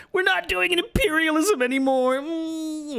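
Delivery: high whiny fake blubbering; mock crying. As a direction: whine it like a mocked crybaby. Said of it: "You're like".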